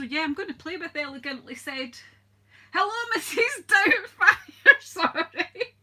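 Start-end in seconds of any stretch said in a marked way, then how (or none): 2.7-5.8 real laughter. Real, not acted. Laughs at a viewer's name.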